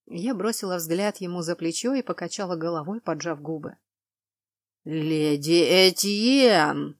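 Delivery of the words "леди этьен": very slow, loud angry growl; stretched, forceful growl; growling warning. A narrator's reading. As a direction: rumble it out as a drawn-out threat. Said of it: "завибрировала от рыка".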